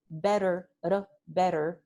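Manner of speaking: better said with a tapped tt; American English flap. Shown as a demonstration. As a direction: tap the tongue once for the tt in better. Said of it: "the double T".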